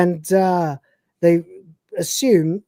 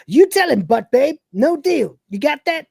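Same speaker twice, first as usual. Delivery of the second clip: higher cocky Cat voice; cat breaking character; 8 semitones higher than usual. Retold or imitated. Cat refusing the trade in his own higher voice. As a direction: snap back high and smug. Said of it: "he goes".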